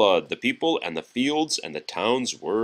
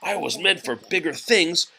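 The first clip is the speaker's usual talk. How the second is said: a touch of a higher, grumpy tugboat; lightly voiced cross little boat; 6 semitones higher than usual. Tugboat cross in the morning, a hint of grumbling. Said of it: "he said".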